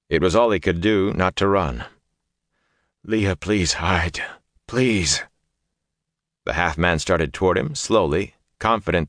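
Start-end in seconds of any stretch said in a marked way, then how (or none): 3.0-5.2 pleading hush. A narrator's reading. Urging Leah to hide, terrified.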